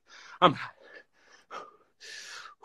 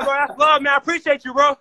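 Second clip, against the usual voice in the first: ordinary delivery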